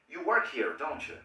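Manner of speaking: "don't you?" falling, sure; confident tag that drops at the end; falling intonation. Shown as a demonstration. Teacher showing certainty with falling intonation. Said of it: "demuestra que yo tengo certeza".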